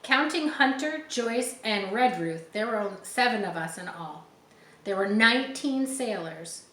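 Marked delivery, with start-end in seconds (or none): none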